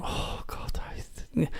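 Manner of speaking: whispered, acted-out dismay; mortified whisper. Storyteller reliving his embarrassment as friend comes downstairs, mortified whisper. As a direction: breathe it out to yourself, cringing.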